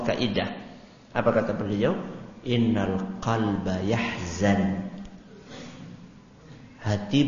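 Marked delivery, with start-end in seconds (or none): 2.4-4.9 slow, measured Arabic recitation; slow, reverent recitation of a hadith. Real, not acted.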